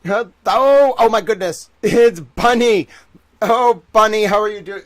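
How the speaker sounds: high, loud delighted hello to a friend; light up and welcome Bunny loudly; excited greeting. Real, not acted. Greeting Bunny arriving in chat.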